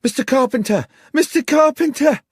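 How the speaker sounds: high, loud, frantic screams of a name; a panicked young woman bursting in, screaming. Acted. Sarah bursts into the bar, screaming for Carpenter. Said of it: "came screaming into the bar".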